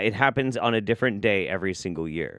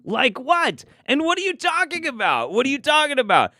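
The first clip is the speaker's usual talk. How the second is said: high-pitched incredulous outburst; exasperated disbelief; 14 semitones higher than usual. Acted. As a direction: question it in a much higher, baffled voice. Exasperated reply to the played clip.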